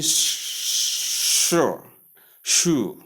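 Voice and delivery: "sure" with a drawn-out sh and oo vowel; mispronunciation demo. Shown as a demonstration. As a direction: show the wrong "sure", like shoo. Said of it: "Common error".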